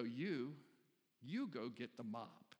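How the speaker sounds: slow, deliberate acted order; directing administrator. Administrator begins directing people after the spill, measured command. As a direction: imitate an organiser handing out jobs. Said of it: "person with the gift of administration".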